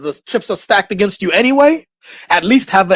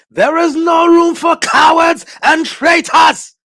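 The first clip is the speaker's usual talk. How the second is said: high shouted war-film commander line; higher, slow, shouted movie battle line; film soldier quote; 11 semitones higher, 3 dB louder, slower than usual. Retold or imitated. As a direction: bellow it like a commissar rallying the ranks. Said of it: "as they said in, uh, Enemy at the Gates".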